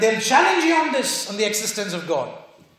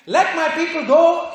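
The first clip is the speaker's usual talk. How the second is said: a decree shouted out; loud commanding declaration; 6 semitones higher, 4 dB louder than usual. Shown as a demonstration. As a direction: declare it with authority. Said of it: "is a commanding voice".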